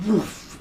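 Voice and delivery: high, sudden whoosh noise; quick high-pitched "woof" of speed. Retold or imitated. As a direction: imitate a lift shooting upward. Imitates lift shooting upward.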